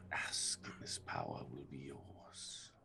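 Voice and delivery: soft eerie whispered queen voice; sickly sweet whisper. Acted. Plague queen voice continues.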